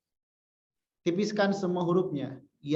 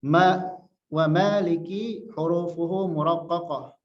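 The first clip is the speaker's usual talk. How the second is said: usual speaking voice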